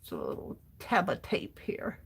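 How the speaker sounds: under-the-breath whisper to herself. Real, not acted. Crafter whispering while taping paper.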